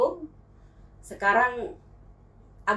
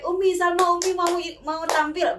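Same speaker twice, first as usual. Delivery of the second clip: calling out to mom, pitched higher; lightly raised, higher calling voice; retold excited call; 7 semitones higher than usual. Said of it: "dia akan teriak".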